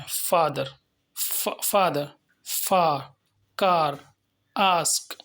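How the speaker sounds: "फादर" with the aa stretched long; "आस्क" with a long, drawn-out aa; long aa vowel. Shown as a demonstration. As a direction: hold the aa in each word. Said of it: "long करके पढ़े गए हैं".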